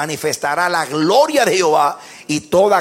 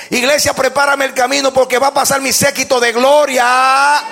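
high, loud pulpit proclamation compared with plain talk; predicación enérgica; 7 semitones higher, 4 dB louder than usual. Real, not acted. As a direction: proclaim each phrase with full force. Preaches at full force. (prédica a toda voz)

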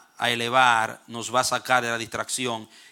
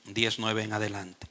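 normal talking voice

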